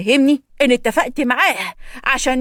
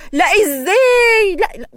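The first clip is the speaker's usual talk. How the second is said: indignant cry; 8 semitones higher, 4 dB louder, much slower than usual. Acted. Indignant refusal, crying out.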